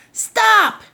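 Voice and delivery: high, loud cry of stop; yelling stop. Acted. Old woman chasing the musubi man, high loud yell. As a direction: call after the runaway loud and high. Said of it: "yelled the little old woman".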